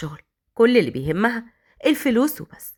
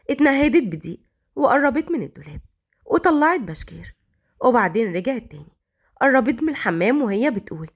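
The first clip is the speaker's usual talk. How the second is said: ordinary delivery, nothing marked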